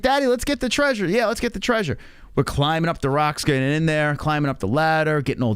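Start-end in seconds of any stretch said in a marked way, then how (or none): 0.0-2.0 imitating little daughter. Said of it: "She's like".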